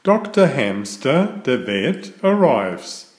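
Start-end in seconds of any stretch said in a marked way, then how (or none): none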